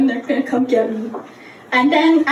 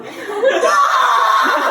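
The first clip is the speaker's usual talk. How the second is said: mock labor scream; 7 semitones higher than usual. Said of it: "the screams I'm going".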